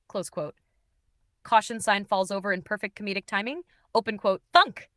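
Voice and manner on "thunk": usual speaking voice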